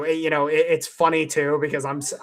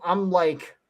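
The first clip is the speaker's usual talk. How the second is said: usual speaking voice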